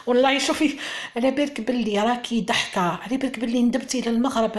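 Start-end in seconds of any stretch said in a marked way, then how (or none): none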